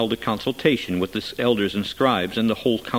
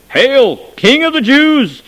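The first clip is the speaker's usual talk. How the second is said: high, loud, slow mocking hail; mock salute; 14 semitones higher, 11 dB louder, slower than usual. A narrator's reading. Soldiers mockingly hailing the prisoner as king, high and loud. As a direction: hail him with exaggerated scorn. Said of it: "began to salute him".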